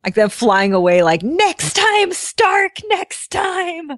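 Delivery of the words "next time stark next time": high, drawn-out villain taunt; hammed-up Whiplash vow to return; villain's parting threat. Comic villain flying away, vowing to return to Stark.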